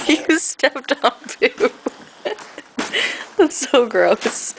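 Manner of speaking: slow, giggling ew; laughing disgust. Real, not acted. Dog stepped in poo, laughing.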